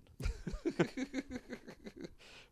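long, high-pitched laugh; real laugh. Real, not acted. Guest laughing about living in Michigan, long high laugh.

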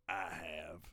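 low, rough mock woman's voice; imitating rough deep voice. Comic mimics heckler lady with a rough deep voice. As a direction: growl it out like a rough old voice. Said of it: "her voice sounded really rough and deep".

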